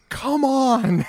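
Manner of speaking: ordinary talk, nothing marked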